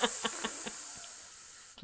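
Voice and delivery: long hissed ending on yikes; snake-like hiss. Pretend. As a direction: stretch the final s into a long hiss. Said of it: "Like a snake".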